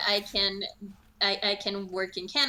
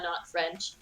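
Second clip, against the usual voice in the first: ordinary delivery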